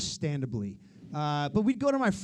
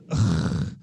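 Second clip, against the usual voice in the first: disgusted ugh; 13 semitones lower, 3 dB louder than usual. Retold or imitated. Teacher's disgusted look.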